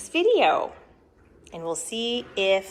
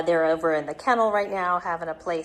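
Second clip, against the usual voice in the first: usual speaking voice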